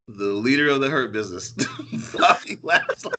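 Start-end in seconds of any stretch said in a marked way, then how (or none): 1.5-3.1 breaking into laughter on the name; can't keep a straight face; laughing. Real, not acted. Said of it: "couldn't even say it with a straight face".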